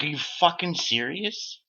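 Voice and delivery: incredulous. Retold or imitated. Confronting the mocking guy.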